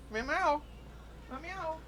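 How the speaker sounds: very high, soft cat meows; meowing. Pretend. Owner meowing back at his cat, very high and soft. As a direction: mew softly like a kitten.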